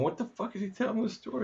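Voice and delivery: high, soft, baffled aside; worried inner thought. Retold or imitated. Host recalling worry during guest's story, soft high bafflement. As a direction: voice a private panic, mostly under the breath.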